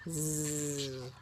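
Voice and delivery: quiet drawn-out zzz; soft snore. Pretend. Showing child a sleeping worm, soft snore.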